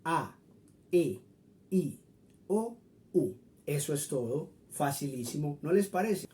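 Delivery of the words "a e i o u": A, E, I, O, U each pure and separate; Spanish vowels. Shown as a demonstration. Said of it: "tal cual como se pronuncia". (vocales españolas puras, una por una)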